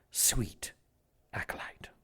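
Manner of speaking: unvoiced, menacing whisper; whispered menace. Villain whispering revenge for his murdered acolyte. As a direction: hiss the word softly like a threat.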